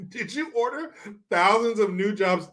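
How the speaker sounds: sarcastic polite question; mock polite disbelief. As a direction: feign polite confusion. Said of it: "I was like".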